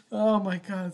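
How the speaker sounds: ordinary talk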